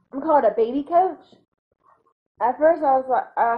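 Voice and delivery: normal talking voice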